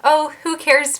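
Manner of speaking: nonchalant. Acted. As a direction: toss it off as if unbothered. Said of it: "to have that nonchalant".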